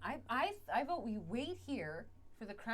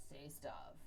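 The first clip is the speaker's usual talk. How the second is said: whispering; 5 semitones lower, 9 dB quieter than usual. Real, not acted.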